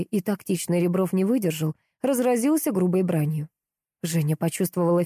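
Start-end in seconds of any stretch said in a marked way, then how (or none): none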